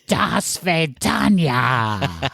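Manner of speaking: drawn-out, breathy, dramatic farewell; dramatic Meg Ryan. Retold or imitated. Imitating Meg Ryan's big dramatic "Do svidaniya" farewell line in Anastasia. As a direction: hold the moment, then stretch "Do svidaniya" very slowly. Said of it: "She holds for it".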